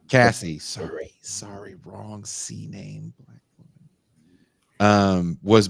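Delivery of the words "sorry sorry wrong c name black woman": soft, breathy embarrassed apology; muttered aside. Real, not acted. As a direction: mutter it under your breath. Muttered aside after a name slip.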